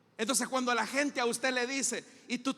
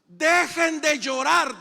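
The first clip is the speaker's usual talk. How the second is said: louder, slow, firm order; firm command; 4 semitones higher, 8 dB louder, slower than usual. Acted. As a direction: stop the crowd with authority. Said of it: "dijo Jesús". (Jesús ordena a las plañideras que callen, firme)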